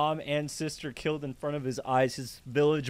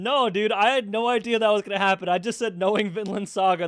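loud, raised-voice denial; insistent protest; 6 semitones higher, 6 dB louder than usual. Real, not acted. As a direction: protest your innocence loudly. Insists he had not read ahead.

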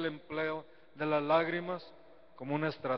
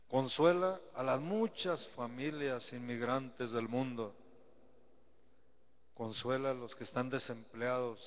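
prayer; 3 dB quieter than usual. Acted. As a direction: pray for the people, unhurried. Leads a prayer.

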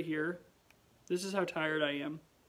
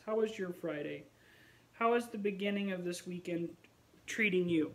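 ordinary delivery

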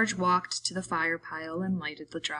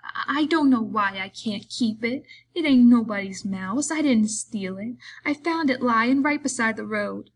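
higher, louder upset pleading; voice breaking a little; 5 semitones higher, 6 dB louder than usual. A narrator's reading. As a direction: plead like a hurt, simple-minded man. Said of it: "His voice broke a little".

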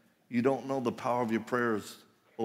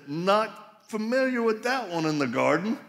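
suspicious Adam; 8 semitones higher than usual. Pretend. Adam challenging unknown voice.

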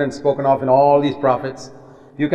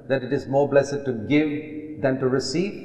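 ordinary delivery

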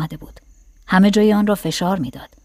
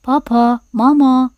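higher-pitched squeaky toy doll call; two short doll words, pitched up; talking doll; 5 semitones higher, 4 dB louder, slower than usual. A narrator's reading. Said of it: "ناگهان عروسک گفت".

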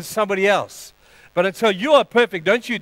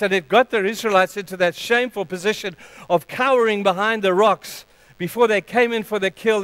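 usual speaking voice

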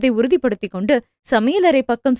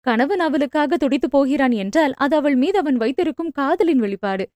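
ordinary delivery

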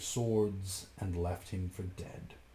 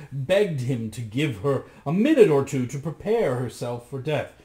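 plain reading voice

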